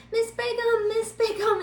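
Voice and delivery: put-on high, eager children calling a teacher's name; a lightly mocked chorus of kids calling out. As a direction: mimic excited kids calling her name. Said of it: "all these kids are like".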